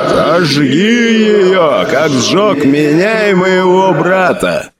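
slow, high, pained ghostly growl; father growling. Acted. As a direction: growl it out between screams. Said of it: "прорычал отец между криками". (отец рычит сыну сквозь крики — рычание)

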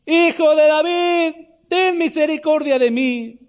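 high, slow, drawn-out cry; full-voiced high wail of a desperate beggar; crying out harder. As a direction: stretch every word in an insistent plea for mercy. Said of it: "él clamaba mucho más".

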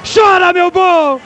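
shouted radio championship call; victory call. Real, not acted. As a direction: belt out the title win. Radio goal call celebration.

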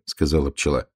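usual speaking voice

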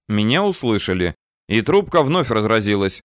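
usual speaking voice

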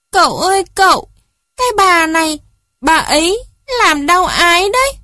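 put-on high, loud childish complaint; whimpering child. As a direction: tattle to uncle as a child on the verge of tears. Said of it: "vừa mếu vừa nói". (giọng trẻ con mếu máo mách cậu, cao vút)

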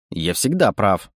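slow, low grumbling claim; grumpy. A narrator's reading. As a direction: grumble it slowly and smugly. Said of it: "сварливо заявил енот".

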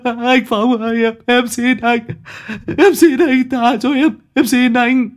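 doomed worker begging the enforcer; desperate pleading. Acted. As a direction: plead for your life, stammering. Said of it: "Nó lắp bắp".

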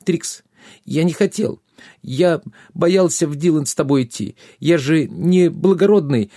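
put-on high, pleading boyish excuse-making; whimpering runaway squire. A narrator's reading.

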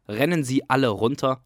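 plain reading voice

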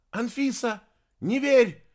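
high, loud warning cry; shouting. A narrator's reading. Girl running to warn Anfisa, shouting. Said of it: "ещё раз крикнула Полика".

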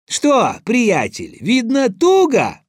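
high, loud mocking question; gloating taunt. A narrator's reading. Tsar taunts trembling Ivan, gloating. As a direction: needle the caught culprit.

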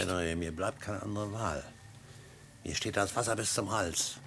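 leise, gedrückt; gebrochen, gedämpft gestanden; leise verzweifelt. Acted. Verzweifeltes Geständnis. (leise verzweifeltes Geständnis gegenüber Henry)

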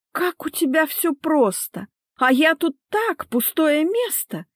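high, drawn-out hurt complaint; offended whine. A narrator's reading. Wife changes tactics on husband, high offended whine. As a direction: play the wronged one, stretching words. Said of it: "обиженно протянула Лика".

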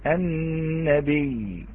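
النبي said slowly with a doubled ya, annabiyy; very slow النبي with a long stressed double ya; demo pronunciation. Shown as a demonstration. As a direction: lean on the shadda in النبي, no hamza. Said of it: "تقرأ بهذه الطريقة".

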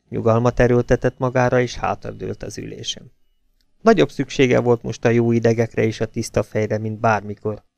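usual speaking voice

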